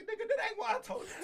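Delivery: high-pitched retold yell, cracking with laughter; laughing mock yell. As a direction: yell it back at him while half laughing. Retold confrontation, quote yelled back.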